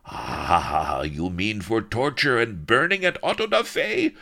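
high, loud manic ranting; roaring villain. Acted. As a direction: roar at the officers. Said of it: "he roared".

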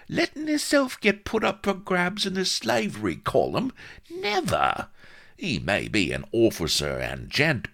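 very high, squeaky character voice; indignant little sidekick working himself into doubt; Vladimir, anxious. Acted. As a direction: squeal it in high-pitched dismay.

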